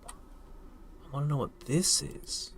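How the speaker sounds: slow curious whisper. Real, not acted. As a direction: breathe it out, puzzled. Puzzling over unknown item.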